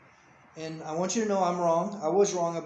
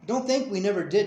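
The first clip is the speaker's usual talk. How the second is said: raised, louder insistent warning; defensive rebuke; 4 semitones higher, 6 dB louder than usual. Real, not acted. Parent corrects child's belief that nothing was done, defensively. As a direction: shut the accusation down firmly. Parent warns against the accusation.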